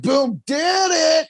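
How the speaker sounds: high, slow gloating shout; mock gloating. Scene: mocking fighter who celebrates too early, gloating shout. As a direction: brag it out like you already won. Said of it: "Don't be like".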